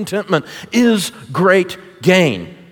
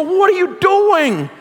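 baffled question; 12 semitones higher, much slower than usual. Retold or imitated. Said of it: "We go".

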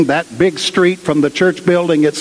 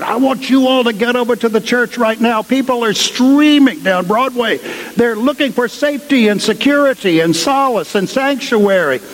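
higher, pressing voice of the rector; rector's urgent order; 7 semitones higher than usual. Retold or imitated. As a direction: hand out orders to the staff, pitched up. Rector orders them to church.

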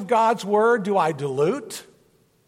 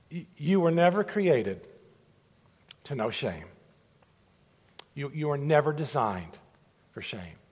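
soft, lower, sincere reassurance on never; tender; 5 semitones lower, 6 dB quieter than usual. Real, not acted. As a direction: speak gently to the hurting. Pastor reassuring about shame.

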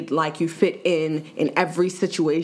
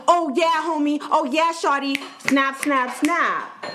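higher-pitched mock slang voice; coworker's put-on slang; 8 semitones higher than usual. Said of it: "to change his voice and his way of speaking".

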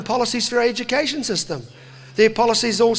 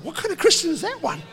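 incredulous laugh; 7 semitones higher than usual. Retold or imitated. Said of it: "I said".

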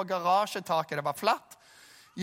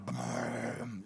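deep slow rumbling brrm; low rumble effect; 8 semitones lower, 9 dB quieter than usual. Retold or imitated. Preacher mimicking a film rumble, low and slow. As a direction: rumble it low like a movie boulder. Movie sound effect in sermon.

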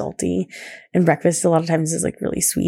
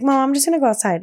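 lightly voiced kid, higher and quick; quick, higher quote of a kid's announcement; imitating daughter; 7 semitones higher, faster than usual. Daughter telling mom she's heading out, lightly imitated. Quoting her daughter asking to play.

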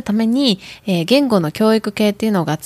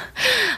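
quick high giggle; a light embarrassed laugh; little laugh while introducing herself; short laugh; 5 dB quieter than usual. Real, not acted. Laughs about her hometowns.

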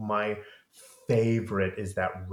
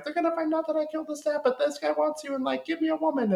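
high fast mock child; hammed-up high demanding Claudia; bratty Claudia; faster than usual. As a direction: rattle off demands like a brat. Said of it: "Claudia's like".

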